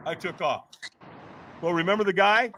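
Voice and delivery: normal talking voice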